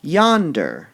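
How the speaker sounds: "Yonder" loud and high; stress on yonder. Shown as a demonstration. Teacher scanning the line aloud, stressing "Yonder" loudly. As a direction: lean into "Yonder". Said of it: "We want to emphasize all those".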